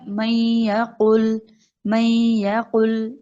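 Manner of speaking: very slow من یا قل, nun merged into ya with a nasal ghunna; slow recitation. Shown as a demonstration. Teacher answering how to read it, slow careful recitation. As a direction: recite من یا قل slowly, holding the ghunna. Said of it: "ہم نے کیسے پڑھنا ہے؟".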